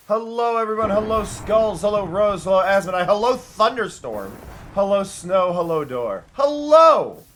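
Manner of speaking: loud roll call of hellos; booming welcome to the crowd; calling out greetings. Real, not acted. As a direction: hail each arrival at full voice. Greets viewers one by one loudly.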